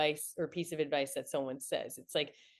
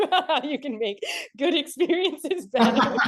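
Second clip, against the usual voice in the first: laughing through the words, higher and louder; 9 semitones higher, 7 dB louder than usual. Real, not acted. Founder laughs at not celebrating her own wins, laughing through it. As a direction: crack up at your own irony.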